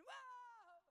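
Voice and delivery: very high whoa, falling away; old woman's whoop. Retold or imitated. Preacher imitates Sherry whooping in the service, very high Whoa. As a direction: whoop like the elderly sister. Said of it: "she'd go again".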